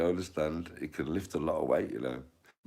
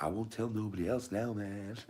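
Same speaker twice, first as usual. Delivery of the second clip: high film-character voice; put-on high promise; Ezel; 7 semitones higher than usual. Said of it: "And then he's like".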